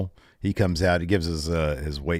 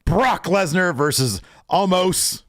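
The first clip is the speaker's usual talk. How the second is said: higher, slow booming announcer; 10 semitones higher, 3 dB louder, slower than usual. Retold or imitated. Brock announcing the WrestleMania match in announcer voice. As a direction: stretch it out like a big match intro. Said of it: "Brock does his best announcer voice".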